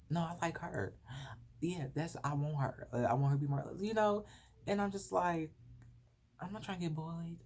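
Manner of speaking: high-pitched, rattled self-defence; defensive retort. Retold or imitated. Acted defensive retort to classmates.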